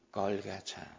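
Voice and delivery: ordinary talk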